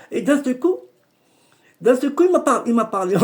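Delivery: high, loud burst of excitement; sudden excitement. Real, not acted. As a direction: blurt it out as it hits you. Djinn suddenly speaks, excited telling. (excitation soudaine)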